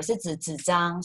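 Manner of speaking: ordinary talk, nothing marked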